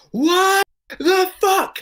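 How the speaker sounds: high, slow, anguished cry of outrage; angry outburst. Retold or imitated. Comedian retelling his festival breakdown, crying out in outrage. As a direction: wail it out in disbelief. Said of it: "a big angry breakdown there".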